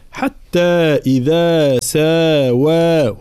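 "حتى إذا ساوى" with every long vowel equally held; equal long madds. Shown as a demonstration. Teacher showing successive madds, "حتى إذا ساوى" evenly drawn out. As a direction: slowly stretch each madd in "حتى إذا ساوى". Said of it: "أعطي كل مد حقه".

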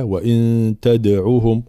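measured وإن تدعوهم with echoed د; bouncing dal. Shown as a demonstration. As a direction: release the د of تدعوهم with a small bounce. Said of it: "هنا الدال مقلقلة". («وإن تدعوهم» بقلقلة الدال)

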